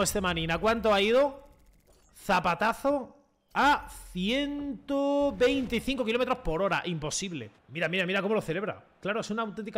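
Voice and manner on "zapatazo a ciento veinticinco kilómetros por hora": comentarista de radio. Pretend. Narra el gol como comentarista.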